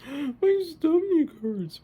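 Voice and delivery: very high, slow, dragged-out whine; whiny kid. Retold or imitated. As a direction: whine it like a worried child. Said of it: "But now they're just like".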